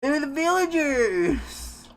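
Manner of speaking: stretched-out high impression of the trailer line. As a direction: belt it high and slow like the trailer.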